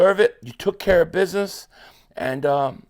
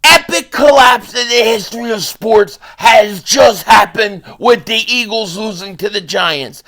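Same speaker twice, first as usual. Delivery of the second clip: loud, punchy rant opener; loud sports-rant intro; hyped-up shout; 7 dB louder than usual. Real, not acted. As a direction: blast it like a sports hot take. Opening hype rant, shouting.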